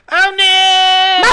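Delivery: high, loud hammed-up wail; mock anguished cry. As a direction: ham up a hero's grief cry. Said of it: "Jubei's like".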